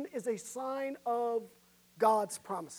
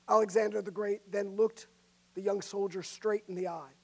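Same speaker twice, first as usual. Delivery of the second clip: ordinary talk, nothing marked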